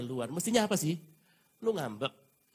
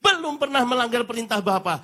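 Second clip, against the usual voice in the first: proud boast; 7 semitones higher, 7 dB louder than usual. Acted. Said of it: "dengan bangganya mengatakan".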